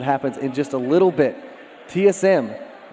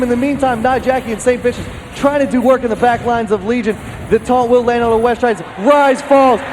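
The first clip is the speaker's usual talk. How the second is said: play-by-play; 11 semitones higher, 6 dB louder than usual. Acted. Live esports play-by-play casting.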